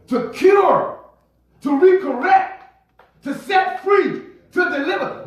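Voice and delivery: high, emphatic preacher's cadence; impassioned preaching. Real, not acted. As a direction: drive each phrase home to the congregation. Preaches at full force.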